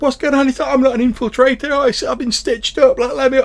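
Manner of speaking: high, loud desperate pleading; pinned technician pleading his innocence; panicked protest. Acted. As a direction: protest innocence under the pile. Accused NPC protests.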